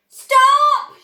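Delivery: a very high, very loud command; shout. A narrator's reading. Grandpa Joe shouting at Wonka, panicked loud shout. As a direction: bellow to halt it. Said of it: "shouted Grandpa Joe".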